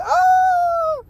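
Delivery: sudden very high yelp of pain; mock cry of a man hit in the ribs; retold scream of the man falling; imitated pained cry. Retold man falling after rib breaks.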